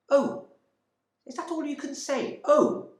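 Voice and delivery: high, loud, shrill mocking of his Oh; hysterical. Hysterical wife screeching at her husband. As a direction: screech his word back at him. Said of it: "She was quite hysterical".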